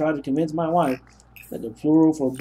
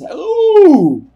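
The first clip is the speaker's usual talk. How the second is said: much higher and louder delighted ooh; a sudden yelp of delight; surprised ooh; 13 dB louder than usual. Real, not acted. Sees the big structure.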